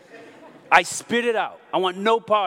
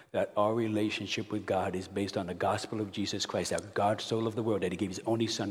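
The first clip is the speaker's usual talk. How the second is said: normal talking voice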